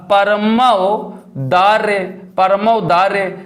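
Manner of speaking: very slow, drawn-out teacher's pronunciation; slow enunciation. Shown as a demonstration. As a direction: stretch the example word out for the class. Teacher spells out example word.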